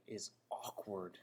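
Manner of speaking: ordinary talk, nothing marked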